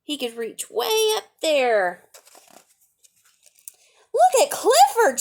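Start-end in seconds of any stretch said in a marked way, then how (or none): 4.1-5.2 high, loud excited shout; child shouting to the crowd in the park, high and loud. Acted. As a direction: call out to the crowd, thrilled. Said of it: "shouted Charlie".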